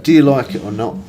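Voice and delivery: uncle asking pointedly. Retold or imitated. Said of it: "He's like".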